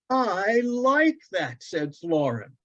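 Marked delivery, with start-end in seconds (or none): 0.1-1.6 put-on high, slow, delighted boy's voice. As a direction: savour it with childlike delight. Boy delighted.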